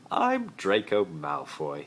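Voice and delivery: loud, slow, formal introduction; polite Malfoy. Acted. Malfoy introducing himself to Uncle Vernon, formal. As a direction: introduce yourself grandly. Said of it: "he paused".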